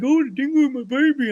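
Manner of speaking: high, silly mock tone.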